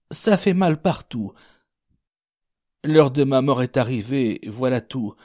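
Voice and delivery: high, understated dying man's complaint; pained lament. Acted. Sick man laments his coming death. (plainte aiguë de malade)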